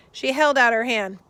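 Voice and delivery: ordinary delivery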